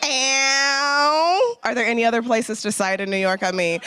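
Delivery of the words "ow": ordinary delivery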